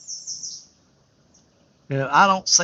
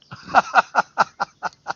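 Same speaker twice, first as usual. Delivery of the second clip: a big, higher laugh; louder, higher burst of laughter; hearty laugh; 6 semitones higher, 3 dB louder than usual. Real, not acted. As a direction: laugh out loud at the joke. Laughing at his own joke.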